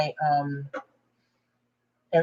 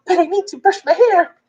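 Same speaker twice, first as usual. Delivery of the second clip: imitating her old dad; 11 semitones higher than usual. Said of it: "'cause he was like".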